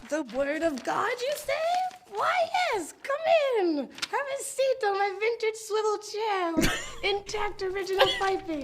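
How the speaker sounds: high, sly witch voice; evil witch voice. Acted. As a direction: purr like a scheming witch. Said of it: "Now I have to do an evil witch voice".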